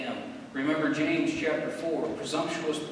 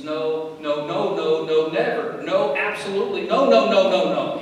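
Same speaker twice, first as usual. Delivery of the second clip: loud, piled-up "no, no, no"; forceful preacher's refusal, far louder than usual talk; emphatic negative; 4 semitones higher, 11 dB louder than usual. Shown as a demonstration. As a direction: hammer every "no" harder, building to a high, loud peak.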